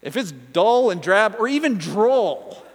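chuckling at own slip; laughing. Real, not acted.

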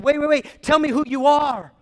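high, loud, urgent call; crying out. Retold or imitated. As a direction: call after someone who is about to leave. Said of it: "I cried out".

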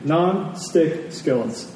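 normal talking voice

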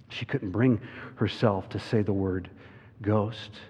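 usual speaking voice

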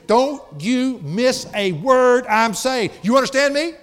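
loud, high, slow imitated scolding; upset mama. Upset mama making her son listen, loud imitated scolding. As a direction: hammer each word out like a cross mother. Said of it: "She was upset".

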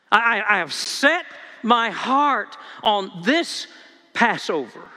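slow, punched words building to "this Passover"; stress on THIS PASSOVER. Shown as a demonstration. Preacher voices Jesus to his disciples, heavy stress on "this Passover". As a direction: set "this" apart, then land "Passover". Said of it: "the word this and Passover are emphatic words".

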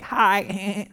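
a high, dumb little "Hi"; dopey sheep greeting. Retold or imitated. Pastor playing a doomed sheep greeting a lion, dopey and high. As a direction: play the clueless sheep waving at the lion. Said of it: "I'd just sit there like".

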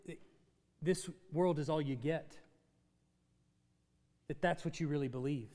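normal talking voice

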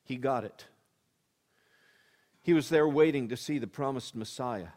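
normal talking voice

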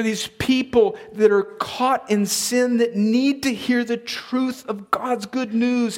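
high, drawn-out preacher's delivery; impassioned preaching. Real, not acted. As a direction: press each phrase home slowly and emphatically. Preaches at full force.

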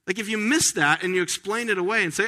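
ordinary delivery

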